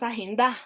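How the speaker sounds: agenda with the final DA punched; misplaced stress, agen-DAH for agenda; stress on last syllable. Shown as a demonstration. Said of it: "¿dónde lleva el acento?".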